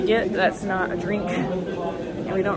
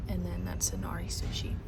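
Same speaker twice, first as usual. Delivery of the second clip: hushed voice, softer throughout; whispering while hiding; 7 dB quieter than usual. Real, not acted. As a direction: keep it down in a quiet lounge. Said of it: "I'm hiding in the bathroom".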